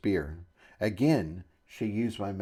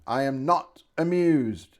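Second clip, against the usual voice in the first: slow, higher, huffy pronouncement; irritated; 4 semitones higher, 4 dB louder, slower than usual. A narrator's reading. Shopkeeper irritated by the visitors, huffily. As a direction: space it out with irritation. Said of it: "in irritation".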